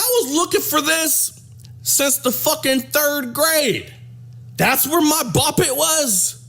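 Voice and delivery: a hint of a stunned kid finding his lost toy; imitating son. Son finds lost toy inside.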